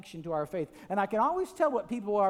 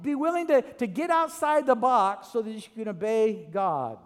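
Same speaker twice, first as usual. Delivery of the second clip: raised, higher preaching exhortation; 6 semitones higher, 4 dB louder than usual. Acted. Pastor rallying the church to step out, at full preaching force. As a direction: urge the crowd on with rising fervor.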